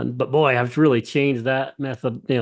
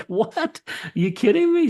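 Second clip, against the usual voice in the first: much higher, incredulous retold shout; astonished disbelief; 10 semitones higher than usual. He reacts to the woman's recovery, much higher in disbelief. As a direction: leap up in pitch, can't believe it.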